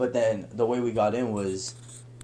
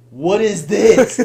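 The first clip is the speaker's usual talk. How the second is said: loud, slow, high incredulous question; hammed-up loud astonishment; mock disbelief; 8 semitones higher, 12 dB louder, much slower than usual. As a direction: act out stunned disbelief, drawn out. Reacting to lady with bats.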